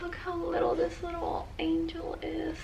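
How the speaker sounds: high, soft unvoiced whisper. Real, not acted. Whispering in awe at a tiny angel ornament. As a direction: hush over something tiny and precious.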